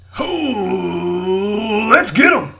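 long rising whoop into a high, loud shout; hammy yell. Acted. Ham of a player riles up the crowd, yelling. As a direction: whoop and holler it like a showboat. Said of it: "I would yell".

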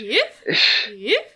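jumpy little yaps; nervous yapping. Acted. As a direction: yap like a nervous gnoll. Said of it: "nervous yapping goes up".

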